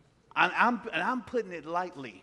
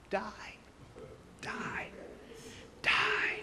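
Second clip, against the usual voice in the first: breathy whispered repeated die; whispered command; 3 semitones lower, much slower than usual. Retold or imitated. The Holy Spirit urging him, whispered.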